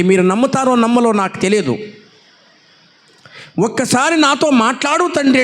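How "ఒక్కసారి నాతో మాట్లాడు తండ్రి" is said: pleading prayer. Retold or imitated. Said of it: "నేను మనసులో అనుకుంటున్నానండి".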